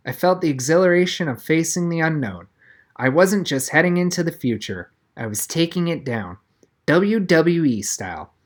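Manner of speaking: usual speaking voice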